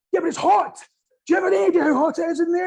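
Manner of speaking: high, loud, rushed panic; mock panicked fireman, fast and shrill-free but high; panicky fireman. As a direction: blurt it out like a frightened fireman. Panicky fireman voiced in anecdote.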